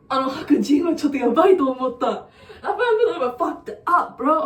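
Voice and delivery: laughing through the words. Real, not acted. Telling friends about the strange woman, laughing. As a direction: giggle it out, fast.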